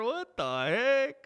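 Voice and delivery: high, slow astonished what the heck; surprised. Real, not acted. Crashing the photo, astonished.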